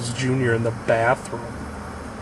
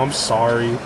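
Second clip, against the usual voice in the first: normal talking voice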